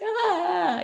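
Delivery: a high mock wail, hammed up; dramatic fake cry. Mom imitating her childhood self crying dramatically in the mirror. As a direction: ham up a kid's sobbing wail. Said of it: "be super dramatic".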